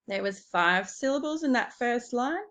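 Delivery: normal talking voice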